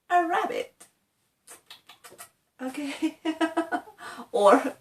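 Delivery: usual speaking voice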